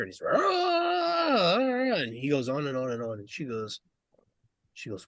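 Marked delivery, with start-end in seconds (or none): 0.4-1.6 high, drawn-out mock wail; fired-up rant noise. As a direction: imitate a worked-up driver ranting in one long noise. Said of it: "he's all fired up".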